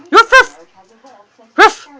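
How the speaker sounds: high playful ruff ruff barks; imitated dog bark. Owner playfully barking at the puppy, high mock barks.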